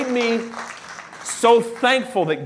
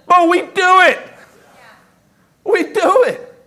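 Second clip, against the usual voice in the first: much higher and louder admission; loud, high sermon emphasis; emphatic preaching; 10 semitones higher, 7 dB louder than usual. Real, not acted. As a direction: confess it loudly to the congregation.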